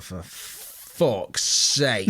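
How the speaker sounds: drawn-out, very slow exasperated curse. Retold or imitated. Husband giving in to his wife, slow and exasperated. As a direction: stretch it out, fed up.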